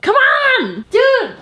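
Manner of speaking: high, loud shout of disbelief at the cat; exasperated yell. Real, not acted. Yelling at her cat for attacking the chair, high loud exasperated shout. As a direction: yell at the cat like it just wrecked your chair.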